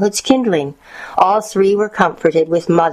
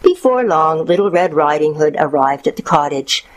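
ordinary delivery, nothing marked

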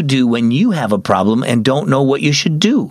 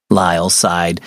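ordinary delivery, nothing marked

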